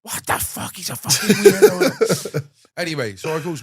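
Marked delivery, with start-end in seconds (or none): 0.0-2.5 whispered start, then a slow louder shout; mock mum shouting. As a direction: play mum yelling in the background. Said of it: "mum's shouting in the background".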